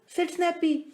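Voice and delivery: ordinary delivery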